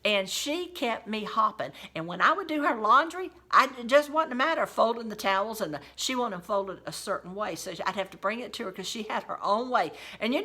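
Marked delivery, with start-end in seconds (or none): none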